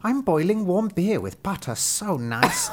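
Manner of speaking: high, meowing cat maid. Acted. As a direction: purr it like a housecat offering beer. Narrator voices the cat maid.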